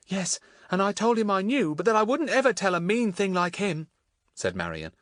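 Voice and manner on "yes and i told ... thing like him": put-on lightly raised girl's voice; gently voiced young girl. A narrator's reading. Voices Marion.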